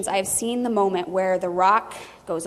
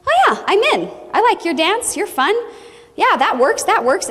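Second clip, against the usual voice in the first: very high, louder eager yes; instantly sold and thrilled; very high, bubbly instant buy-in; eager believer; 8 semitones higher, 3 dB louder than usual. Retold or imitated. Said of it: "They're just like".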